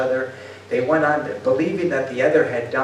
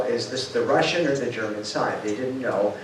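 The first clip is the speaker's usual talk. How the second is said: ordinary talk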